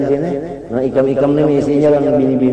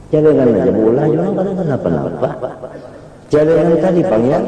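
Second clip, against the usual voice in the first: ordinary talk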